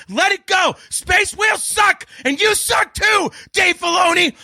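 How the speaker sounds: high, slow, shouted insult; retold yelling. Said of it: "I'm like".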